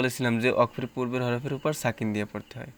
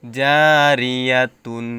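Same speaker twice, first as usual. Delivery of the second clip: very slow, louder chanted recitation; Quran recitation; 7 dB louder, much slower than usual. Shown as a demonstration. Teacher building up a word, chanting it slowly. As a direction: stretch the word out like tilawat. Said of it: "তা দুই পেশ তুন".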